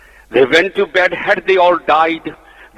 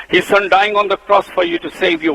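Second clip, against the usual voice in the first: ordinary delivery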